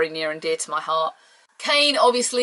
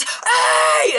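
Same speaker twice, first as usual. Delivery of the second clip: high loud hey from the crowd; crowd shout; 4 dB louder than usual. Retold or imitated. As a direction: yell like a shocked crowd. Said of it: "everyone goes".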